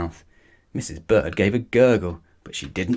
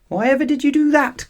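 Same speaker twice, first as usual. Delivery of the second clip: much higher, louder cry of dismay; 5 dB louder than usual. A narrator's reading. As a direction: cry out in disbelief. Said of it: "cried Mister Brown".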